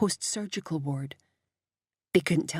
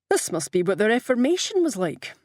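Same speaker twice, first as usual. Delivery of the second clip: higher, louder, cheerful lift; 5 semitones higher, 5 dB louder than usual. A narrator's reading. Brian joking to Morrow about the vote, cheerful. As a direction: say it with cheery relish. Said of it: "said Brian cheerfully".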